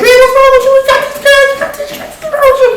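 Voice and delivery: very high, loud squeaky protest; cousin's high protest. Acted. Cousin in the back seat protesting, very high squeal.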